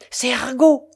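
loud, high cry of alarm. Acted. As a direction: shout the warning in fright. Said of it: "s'écria Mélanie".

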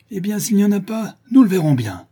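high, loud challenge; threatening retort. A narrator's reading. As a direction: throw it back as a defiant ringleader. Le charron menace de vérifier. (défi menaçant, crié)

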